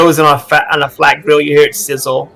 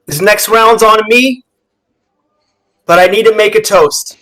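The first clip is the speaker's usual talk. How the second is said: loud, raised-pitch announcement to the bar; calling out; 8 semitones higher, 6 dB louder than usual. Acted. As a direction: announce a toast over the crowd. Captain addresses the whole bar.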